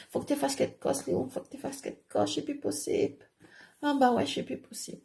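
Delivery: high, soft, feeble plea; acted pleading. Begging Léon to do something, high soft plea. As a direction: plead high and faint, mock-distressed.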